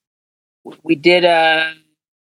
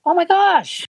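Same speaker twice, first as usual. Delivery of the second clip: very high, awed exclamation; retold amazement; slower than usual. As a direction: react to a screaming crowd, pitched way up. Retold reaction to screaming crowd.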